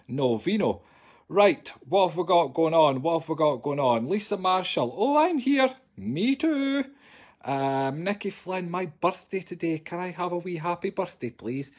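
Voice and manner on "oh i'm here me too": squeaky answer. Pretend. Squeaky reply to comment.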